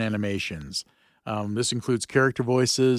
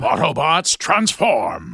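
Optimus Prime voice; 5 semitones higher, 6 dB louder, slower than usual. Acted. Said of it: "That was [name] live".

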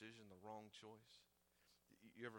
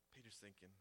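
ordinary talk, nothing marked

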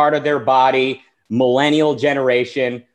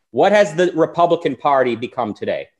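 normal talking voice

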